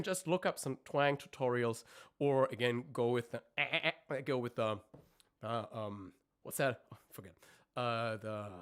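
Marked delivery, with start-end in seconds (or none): none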